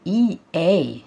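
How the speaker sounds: "اي اي" spelled out letter by letter; name each letter of "اي اي" distinctly. Shown as a demonstration. Teacher spelling the two letters for pupils, letter by letter. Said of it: "ايه ال two letters بتوعنا هنا؟".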